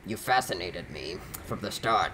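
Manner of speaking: low raspy smoker voice. Acted. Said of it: "the smoker voice for this whole dude".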